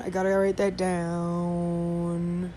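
slow, drawn-out sing-song with the down held long. Acted. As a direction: stretch the down into a long dowwwn. Repeats the phrase, drawn out.

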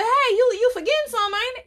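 high, sassy reminder; teasing reminder. Retold or imitated. To daddy saying good night on the phone, high teasing reminder. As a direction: nudge him like a pouting kid.

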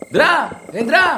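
loud, high shout of the name; shouting his name. Acted. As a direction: yell for him into the dark night. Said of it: "iki malah teriak-teriak". (berteriak keras memanggil nama)

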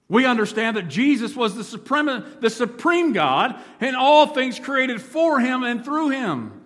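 preaching at full force. Acted. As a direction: proclaim the verse to the rafters. Preaching climax.

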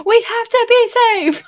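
mock the anxious little boy insisting on rules; a mother parodying her son lecturing other kids, high and prissy; imitating cautious son.

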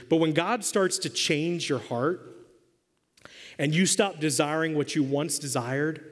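ordinary talk

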